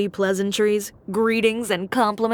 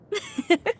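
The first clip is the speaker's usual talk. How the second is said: haughty laugh; 9 semitones higher than usual. Acted. Confident laugh about her boutique.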